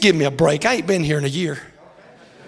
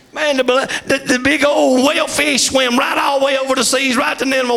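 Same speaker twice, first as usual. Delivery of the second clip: fired-up preaching; 9 semitones higher than usual. Acted. Preacher tells the congregation of the whale, shouting. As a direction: lift the story to a shout.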